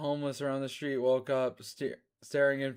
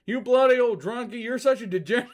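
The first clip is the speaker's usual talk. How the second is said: high, loud sneering insult; high sneer breaking into a laugh; Starlo's voice; 9 semitones higher, 7 dB louder, faster than usual. Acted. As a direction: spit it at the drunk. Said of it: "Starlo said this".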